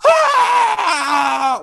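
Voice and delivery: high, loud held scream of a bitten man; long scream. Acted. Bitten man batting at his attacker, screaming. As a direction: scream it out at full pitch. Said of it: "he starts screaming".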